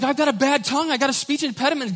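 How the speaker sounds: high, whiny complaint; whiny Moses. Acted. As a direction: whine about your own tongue. Moses complaining about his tongue.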